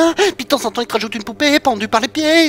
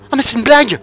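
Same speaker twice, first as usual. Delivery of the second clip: exasperated outburst; 5 semitones higher, 9 dB louder than usual. Real, not acted. Outraged at door.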